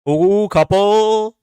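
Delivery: high, loud, drawn-out ooh; thrilled at a close guess; excited. Real, not acted. Close guess in word game.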